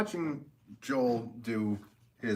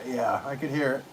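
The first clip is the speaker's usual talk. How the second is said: ordinary delivery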